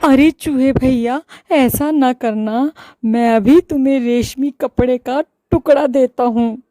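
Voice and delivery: scared shopkeeper pleading; frightened. Acted. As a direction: beg in a panic. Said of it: "डर कर बोला".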